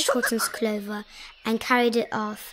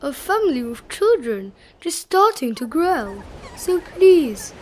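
lightly voiced elephant, gently insistent; Horton, earnest elephant; 2 semitones higher, 4 dB louder than usual. A narrator's reading. Elephant asking that a little family be spared, mildly pleading. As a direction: plead a little, leaning on key words.

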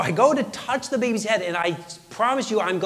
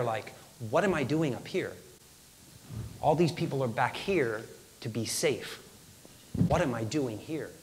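hushed, lower, halting storytelling; grave dread; 5 semitones lower, 7 dB quieter than usual. Retold or imitated. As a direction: let the dread sit between phrases. Baby not breathing, worst moment.

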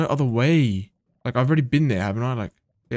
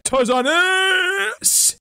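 mock Tarzan call; 4 dB louder, much slower than usual. Jumping, calling a Tarzan cry.

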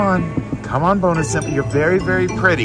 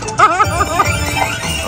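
high giggle; 14 semitones higher than usual. Real, not acted. Laughs about the big payout.

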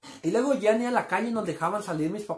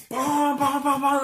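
higher, punchy pam pam; impact sound effects; 6 semitones higher, 4 dB louder, much slower than usual. Retold or imitated. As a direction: hit every pam like a stone on metal. Rocks hitting tin roof.

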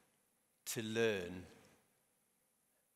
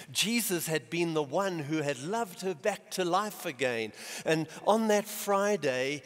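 higher, louder impassioned sermon voice; preacher pouring feeling into the story; fervent preaching; 4 semitones higher, 3 dB louder than usual. Acted. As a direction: build the story with rising passion. Preaching passage rising in intensity.

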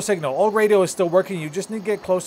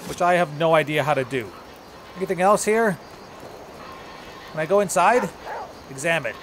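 ordinary talk

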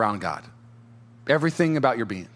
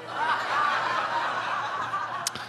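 breathless mocking laugh; high airy cackle; 12 semitones higher, 7 dB quieter than usual. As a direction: cackle like a gleeful cynic. Mocking the cynic's glee.

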